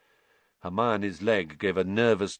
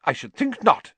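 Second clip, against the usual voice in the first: high, loud, sharp retort; sharp, pitched-up disapproval; 7 dB louder than usual. A narrator's reading. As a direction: cut in indignantly. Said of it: "said Professor McGonagall sharply".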